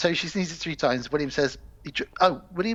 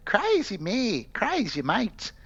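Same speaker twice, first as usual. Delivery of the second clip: high leprechaun voice slipping into Australian; botched leprechaun accent; 7 semitones higher than usual. Acted. Leprechaun crowing about his wedding night, botched Australian-sounding accent. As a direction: attempt a leprechaun, land nearer Australian. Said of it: "It went more Australian".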